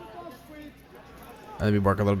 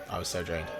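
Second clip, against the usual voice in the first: much softer, trailing off; quiet; 4 semitones lower, 11 dB quieter than usual. Real, not acted. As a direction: drop it to a tired hush. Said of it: "I was just so drained".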